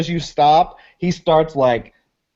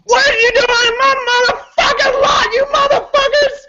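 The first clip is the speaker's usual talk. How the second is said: high-pitched frantic yelling; wild, high-voiced crazed ranting; crazy crackhead; 3 dB louder than usual. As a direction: screech like an enraged crackhead. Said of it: "crazy ass looking crackhead who's like".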